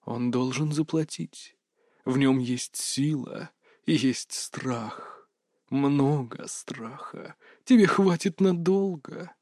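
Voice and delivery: quiet, slow, insistent inner voice; whisper-like dark voices urging; parasite inside human voice. A narrator's reading. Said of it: "Тихие, еле слышные, но настойчивые".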